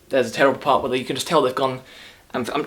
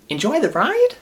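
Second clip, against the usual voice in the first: mocking cheery guide; 13 semitones higher, 4 dB louder than usual. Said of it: "decides to repeat again".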